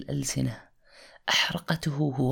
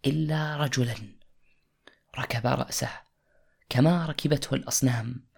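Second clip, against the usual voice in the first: ordinary talk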